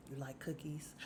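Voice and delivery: low, soft imitation of an odd man; comic near-whisper; hushed offer. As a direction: murmur it in a mock-secretive voice. Imitates Tommy's hushed voice, then laughs.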